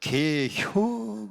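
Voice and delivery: comically stretched Chungcheong one-worder; Chungcheong dialect. Retold or imitated. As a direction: imitate a slow Chungcheong reply. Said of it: "충청도 사람들은".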